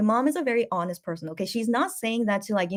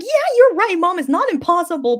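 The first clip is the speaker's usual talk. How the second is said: high, louder mock agreement; mock eager; 9 semitones higher, 8 dB louder than usual. Daughter retelling her playful agreement with mom, high and loud. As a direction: play along with mom, over-eager. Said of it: "And I'm like".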